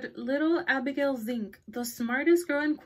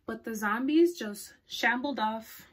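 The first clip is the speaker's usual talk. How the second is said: ordinary delivery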